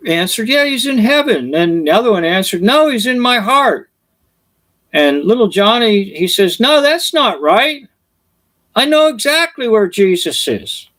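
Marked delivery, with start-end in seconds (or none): 0.5-1.5 put-on high eager child answer; child's voice. Child answering the Sunday school teacher, high and eager. 2.6-3.9 put-on high sincere child answer; a small kid sure of himself, pitched up; child's voice. Second child answering the teacher, high and earnest. 6.6-10.9 put-on little boy who knows better, pitched up; child's voice. Little Johnny correcting the teacher, high and insistent.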